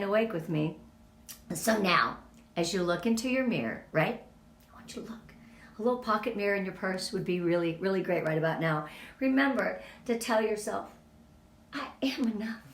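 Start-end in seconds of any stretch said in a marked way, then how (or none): 4.7-5.2 breathy whispered instruction; soft intimate whisper. Real, not acted. Asks listeners to look in mirror. 11.7-12.6 a private whispered mantra; hushed self-affirmation. Shown as a demonstration.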